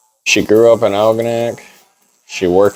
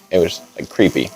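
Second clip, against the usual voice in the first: usual speaking voice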